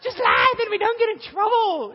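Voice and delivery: high, loud laughing yell; laughing shout. Acted. Preacher mocking her own excuse, loud laughing shout. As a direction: blurt it out laughing.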